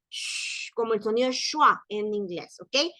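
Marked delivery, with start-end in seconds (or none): 0.1-0.7 long voiceless SH; soplo de aire. Shown as a demonstration. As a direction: push air out in a steady "sh". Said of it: "la pronunciación es como". ("sh" soplada y sostenida)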